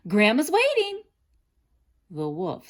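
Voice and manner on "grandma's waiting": put-on high, loud hurried girl's voice. A narrator's reading. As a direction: rush it out like a nervous girl. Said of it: "said quickly".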